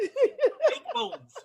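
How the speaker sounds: high squealing laugh through the words. Real, not acted. Laughing loudly to his friend about devil-planted fake bones. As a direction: burst out laughing as you say it. Laughing outburst at absurd theory.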